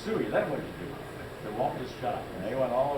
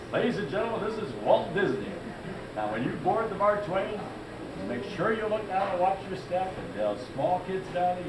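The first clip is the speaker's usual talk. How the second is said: high-pitched boat announcer spiel; imitated tour-boat announcement, pitched up and projected; Walt Disney doing spiels; 8 semitones higher than usual. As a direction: welcome boarding guests like a showman captain. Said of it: "and he'd do the spiels".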